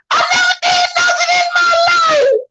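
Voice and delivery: high, crying mock whine. Comedian mocking the suspect's whiny plea of innocence. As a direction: mimic the man whining innocence. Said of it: "stopped talking about his record".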